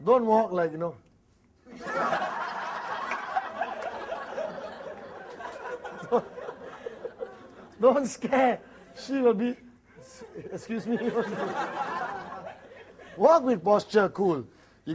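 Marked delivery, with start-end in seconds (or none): 1.8-4.9 laugh. Real, not acted. Speaker laughs after joke. 11.0-12.5 laugh. Real, not acted. Laughing at own joke.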